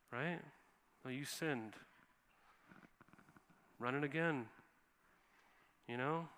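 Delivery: a hint of an aloof god shrugging off suffering; lightly voiced distant God. Imagined distant God brushing off people's suffering, soft and careless. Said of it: "We could picture God like that".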